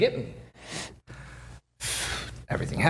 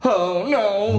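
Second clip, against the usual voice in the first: mock panic; 10 semitones higher than usual. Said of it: "now I'm thinking he's in the ring".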